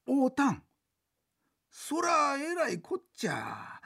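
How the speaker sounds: slow, drawn-out amazement; stunned exclamation of disbelief; amazed. Acted. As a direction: let the shock sink in slowly. Reacting to news of meeting.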